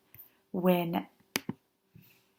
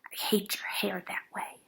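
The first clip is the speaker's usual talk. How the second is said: unvoiced mean whisper; whispered note; 8 dB quieter than usual. A narrator's reading. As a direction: read the nasty note under your breath. Mean note read aloud.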